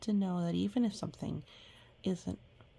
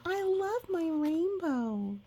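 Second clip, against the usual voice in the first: put-on much higher, slow, delighted child voice; joyful little girl; 8 semitones higher, slower than usual. A narrator's reading. Little girl showing Mom her new hair, delighted. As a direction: squeal it like a thrilled kid. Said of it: "Trinity said".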